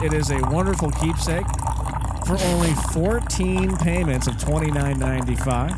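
hammed-up infomercial pitch; hard sell ad. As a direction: sell it like late-night TV. Mock infomercial for mug.

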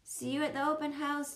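high-pitched call, not really shouted. A narrator's reading. Dad calls after his child running to the bus, lightly calling. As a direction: lightly call a goodbye from a distance. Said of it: "Dad called".

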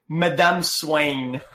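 imitating a botched, drawn-out "Madame Swain"; retold mispronunciation. Retelling a friend's French slip, "Madame Swain" mockingly drawn out. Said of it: "he said, "Madame".

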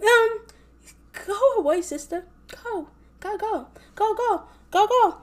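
high, sassy shooing; shooing sass. Retold or imitated. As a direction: shoo her off with mock sass, high. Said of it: "I was just like".

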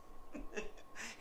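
breathy burst of laughter at the punchline; real laugh. Real, not acted. Laughing at the bartender's question. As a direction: let the laugh escape, almost silent. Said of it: "And I started laughing".

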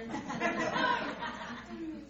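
high laugh burst; hearty laugh. Real, not acted. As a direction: laugh out at the joke. Laugh at Vicks myth.

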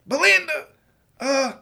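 stage-whisper trying to be sneaky. Acted. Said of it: "like, a whisper voice".

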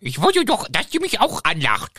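high, hurt little whine of a sulking villain; whiny hurt Voldemort. Acted. Voldemort jammert gespielt.

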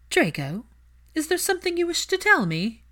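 a mother insisting on the truth; Narcissa's voice. A narrator's reading. Narcissa demands the truth from her son, high and insistent.

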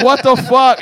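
loud what the fuck; disbelieving. Retold or imitated. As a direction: blurt out in shock. Friend reacts in disbelief.

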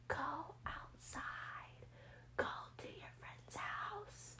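slow high whisper. Retold or imitated. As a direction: whisper like an inner voice. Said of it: "little voice in my head saying".

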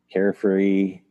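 ordinary talk

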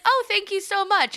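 sweet high voice, politely gushing; high gushing thank-you; high imitation of host. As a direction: lift the pitch way up, bright and gracious. Imitating host's reply.

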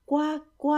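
high-pitched imitation quacks; duck quacking. Ducklings showing off for the noble duck, quacking. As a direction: sing out little quacks.

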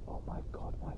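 whispered gasp at cold fingers. Real, not acted. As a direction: breathe it out, freezing.

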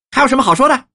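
high, loud challenge to a captive; domineering wife's pointed question; imperious interrogation. A narrator's reading. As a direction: demand an answer with full authority. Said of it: "正欲发挥她的慈威".

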